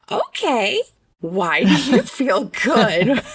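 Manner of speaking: slow, drawn-out question with a laugh in it; careful incredulous question. Retold or imitated. Mom asking her son to explain himself, carefully incredulous. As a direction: stretch it out, amused and baffled. Retells composing herself to ask son.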